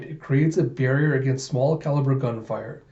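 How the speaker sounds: plain reading voice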